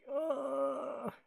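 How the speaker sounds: high crying ugh, a touch of tears; little weepy ugh after the movie; mock sob. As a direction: imitate a tearful groan. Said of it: "I was like".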